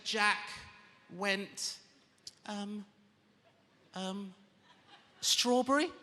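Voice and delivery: put-on hesitant kid sounding it out, guessing; slow halting kid who guesses the end; mimicking his son stumbling over a word; imitating child reading. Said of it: "when he goes".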